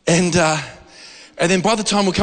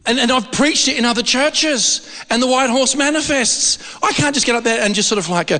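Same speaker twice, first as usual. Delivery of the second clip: usual speaking voice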